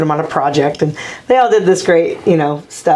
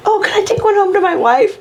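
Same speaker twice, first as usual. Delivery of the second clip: higher-pitched imitation of an eager request; pleading coach; 12 semitones higher than usual. Coach asking player for a rose, lightly acted plea. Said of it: "and he goes".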